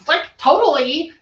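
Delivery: put-on high Valley Girl lilt, rising at the end. Mimicking English friends' American accents, Valley Girl. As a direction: echo it as a mock California Valley Girl. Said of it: "Valley Girl, California".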